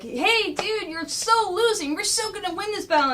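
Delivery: high, lightly voiced taunt; cocky Donnie. Donnie taunting Shredder mid-battle, lightly voiced cocky taunt. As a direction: pitch it up and crow. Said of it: "Donnie turns up to Shredder and goes like".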